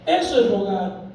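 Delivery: normal talking voice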